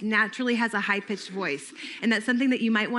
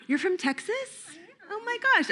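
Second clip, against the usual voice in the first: much higher, delighted rise of surprise; excited matching; 10 semitones higher than usual. Shown as a demonstration. Counselor demos enthusiasm to the volunteer, excited gushing. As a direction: gush over where the child is from. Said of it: "what I just did".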